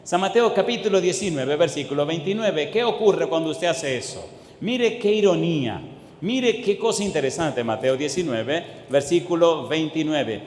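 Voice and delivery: normal talking voice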